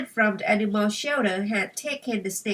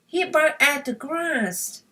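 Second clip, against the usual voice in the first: usual speaking voice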